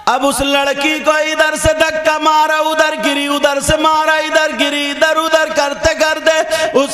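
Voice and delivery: impassioned religious storytelling; fervent preaching. A narrator's reading. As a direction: preach the story at full force to the crowd.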